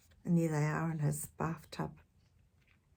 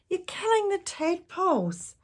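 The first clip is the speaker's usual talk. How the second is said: puffing; 12 semitones higher, 4 dB louder than usual. Acted.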